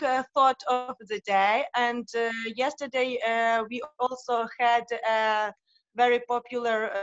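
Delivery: non-native English with a Ukrainian accent; hesitant Ukrainian-accented English. Real, not acted. Uh, I'm from Ukraine.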